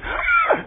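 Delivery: sharp high gasp of alarm; shocked gasp. Retold or imitated. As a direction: gasp in horror at the car. Onlooker gasps at the car.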